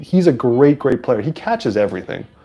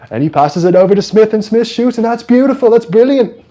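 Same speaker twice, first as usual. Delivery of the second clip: put-on high, louder English-accented match commentary; English soccer announcer; 10 semitones higher, 5 dB louder than usual. Streamer imitates English announcers calling a goal, English accent. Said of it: "one of those English announcers".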